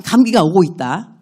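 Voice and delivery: ordinary delivery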